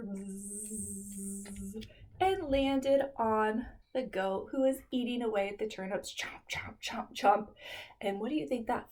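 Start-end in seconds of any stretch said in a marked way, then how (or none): 0.0-1.9 very soft, low buzzing; storyteller buzzing as the fly flies over. Acted. 6.2-7.5 soft, breathy chomps; munch it out softly; storyteller chewing as the goat eats turnips; chomping sounds. Acted.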